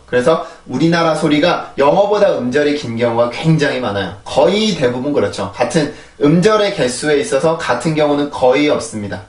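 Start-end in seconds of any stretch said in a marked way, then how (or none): none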